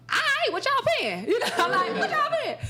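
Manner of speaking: high, giggly what y'all paying; cheeky laughing bargain; laughing ask. Retold or imitated. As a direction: tease them about the money through laughter. Asking pay, laughing.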